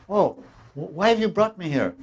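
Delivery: high, loud bewildered protest; Lord Chaitanya waking. Retold or imitated. Chaitanya woken on the sand, high loud bewildered cry. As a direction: cry out, pulled back from trance.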